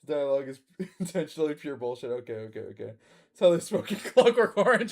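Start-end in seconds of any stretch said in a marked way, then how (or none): 2.9-4.9 laughing. Real, not acted. Laughing comment.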